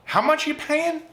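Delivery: put-on eager young self. Eager son asks questions.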